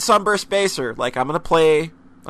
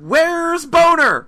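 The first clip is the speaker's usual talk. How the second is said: calling out; 10 semitones higher, 6 dB louder, much slower than usual. Real, not acted. Calling for absent friend.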